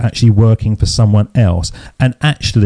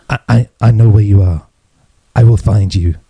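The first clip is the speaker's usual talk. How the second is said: lightly imitated film threat, a bit lower; a hint of the Taken speech, measured; Liam Neeson; 3 semitones lower than usual. Quoting the speech from Taken, lightly imitated film threat. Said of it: "that speech that Liam Neeson does".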